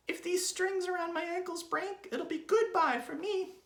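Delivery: very high, loud squeaky worry; Mrs. Twit's anxious gasp. A narrator's reading. Wife tied to balloons, high anxious gasp. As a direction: squeal the question in alarm. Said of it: "she gasped".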